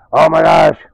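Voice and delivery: a loud, delighted oh my gosh; excited exclamation. Real, not acted. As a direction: blurt it out, thrilled. Excited at more tomatoes.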